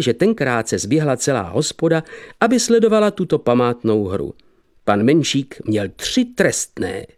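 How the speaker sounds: ordinary delivery, nothing marked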